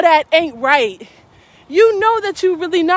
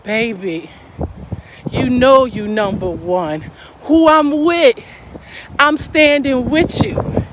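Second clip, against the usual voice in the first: low, slow, smooth sweet talk; lightly voiced calm boyfriend soothing her; imitating the boyfriend smoothly calming his angry girlfriend; calm smooth man; 3 semitones lower, slower than usual. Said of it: "Shooter calmly says".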